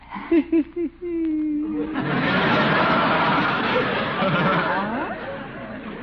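long high embarrassed giggle; nervous giggle. Acted. Gildersleeve caves to Adeline, long high nervous giggle. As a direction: giggle helplessly as you give in.

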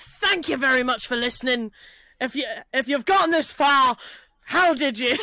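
attempted Cumbrian sliding into Scottish; accidental Scottish. Trying Tom's sign-off for co-hosts, accidentally Scottish accent. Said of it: "Why has he gone Scottish?".

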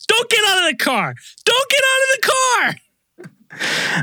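high, urgent mock yell, rising on the repeat; frustrated operator. Host imitating the operator warning the driver, frustrated and urgent. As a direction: plead it with mounting exasperation. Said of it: "probably so frustrated".

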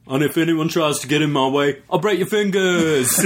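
mock menacing promo voice; mock tough-guy threat. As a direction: parody his scripted threat while amused. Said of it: "he says something along the lines of".

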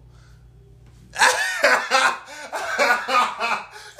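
long high laugh; long loud laugh. Real, not acted.

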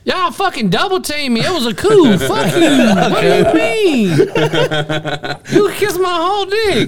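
high, loud, laughing protest; laughing outraged yell. Real, not acted. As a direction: yell through the laughter, mock-betrayed. Outraged after being double-teamed at Uno.

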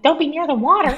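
high, fussing parent warning; mock a mother's nagging worry; worried mom voice. Teasing friends by mimicking an anxious mom. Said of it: "They're like".

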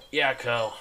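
just woken up, groggy; drowsy answer. Acted. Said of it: "in a very groggy voice".

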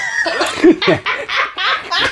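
high, helpless burst of laughter; amused high-pitched laugh. Real, not acted. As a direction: crack up at the outtake. Laughing at the outtake.

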